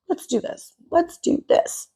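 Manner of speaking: hushed, conspiratorial pep talk, imitating them; mock hyped whisper. Commentator imitating the couple's decision, mock eager whisper. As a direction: whisper it like a gung-ho secret plan. Mocking their decision to double down.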